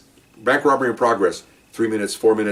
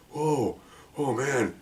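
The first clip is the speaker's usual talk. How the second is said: slow, soft, drawn-out awe; hushed mock amazement; stunned reaction; 9 dB quieter, much slower than usual. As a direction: imitate someone letting it sink in. Partner stunned by the story.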